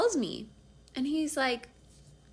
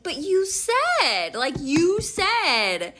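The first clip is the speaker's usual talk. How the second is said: loud, high, drawn-out complaint; indignant protest; 7 semitones higher, 6 dB louder, much slower than usual. Retold or imitated. Woman holding boyfriend to his promise, indignant. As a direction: stretch the words out in reproach.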